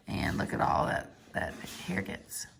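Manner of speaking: whisper. Real, not acted. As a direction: hush and whisper it. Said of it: "aside to listeners".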